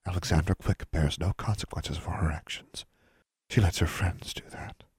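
soft, accusing whisper; haunting whisper. A narrator's reading. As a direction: whisper it like a voice in her head. Remembered words ringing in her ears.

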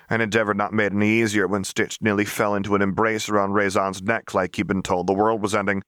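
ordinary delivery, nothing marked

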